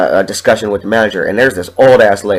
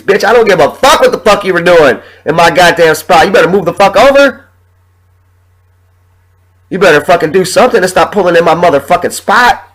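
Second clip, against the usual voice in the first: loud, much higher furious rant; angry venting; 6 semitones higher, 4 dB louder than usual. Real, not acted. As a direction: let it all out, shouting at the world. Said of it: "now that I'm venting a little bit".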